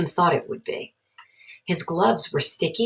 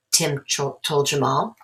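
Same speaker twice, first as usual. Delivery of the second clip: plain reading voice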